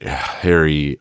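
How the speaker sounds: ordinary talk, nothing marked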